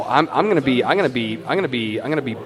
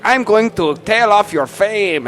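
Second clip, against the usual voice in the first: high, slow, drawn-out mock proclamation; mock comedian's big announcement, pitched up; Carlos Mencia impression; 6 semitones higher, slower than usual. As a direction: stretch it out in a raised, hammed-up comic voice. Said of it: "Carlos Mencia is like".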